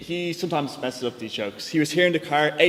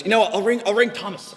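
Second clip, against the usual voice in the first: insistent dad; 6 semitones higher, 3 dB louder than usual. Retold or imitated. Dad insists during car argument.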